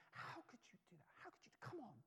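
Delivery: father hissing at his daughter so others won't hear; hissed scolding. Retold or imitated. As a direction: scold under his breath in a shop.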